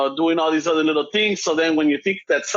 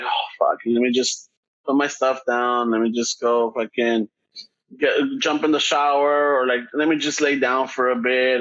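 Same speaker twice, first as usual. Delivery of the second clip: low, slow, drained mumbling to oneself; worn out; 4 semitones lower than usual. Retold or imitated. Burned-out worker just getting home, worn out. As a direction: open on a sighed curse, then trudge on low and slow.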